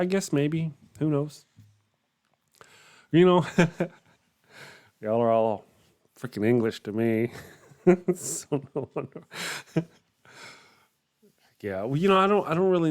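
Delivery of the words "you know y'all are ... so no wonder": amused laughter. Real, not acted. Amused at others' English.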